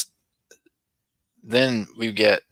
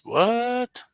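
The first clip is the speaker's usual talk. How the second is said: sharp, much higher startled "What?"; surprised; 3 dB louder than usual. Real, not acted. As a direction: jump up in real surprise. Surprised at a chat message.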